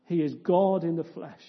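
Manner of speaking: ordinary talk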